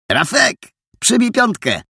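wyższy, zaczepny okrzyk chłopaka; rzuć to wysoko i kumpelsko; Adaś wołający. A narrator's reading. Adaś woła Rafała. (wysoki, kumpelski okrzyk Adasia)

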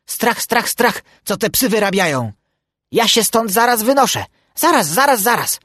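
high, squawky fretting drake; chattering duck. A narrator's reading. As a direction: jabber in alarm like a flustered duck. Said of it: "Majtał obolałą głową i dogadywał".

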